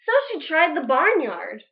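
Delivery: ordinary delivery, nothing marked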